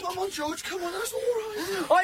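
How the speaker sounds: high, drawn-out comforting croon; soothing a baby. Acted. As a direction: reassure the baby, pitched high. Soothing a baby during a struggle.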